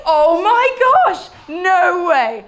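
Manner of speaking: high, loud, stretched out astonishment; amazed woman. Acted. Healed woman marvelling at her cure. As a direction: gasp out the wonder of being healed. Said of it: "She's like".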